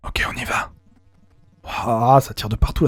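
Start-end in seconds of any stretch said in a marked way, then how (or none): 0.1-0.7 soft breathy ASMR whisper. Shown as a demonstration. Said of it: "je vous la fais en A S M R".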